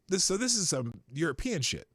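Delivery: ordinary delivery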